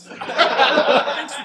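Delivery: upbeat TV host opening; show host intro. Acted. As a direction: welcome the viewers like a bar show host. Host opens bartender segment.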